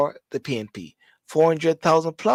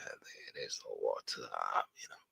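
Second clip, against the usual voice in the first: drops to a whisper for the aside; 19 dB quieter than usual. Real, not acted. A Jamaican man quietly conceding not every area lacks water, whispered. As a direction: murmur it under your breath.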